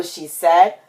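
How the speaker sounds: usual speaking voice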